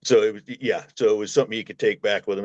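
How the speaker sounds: ordinary delivery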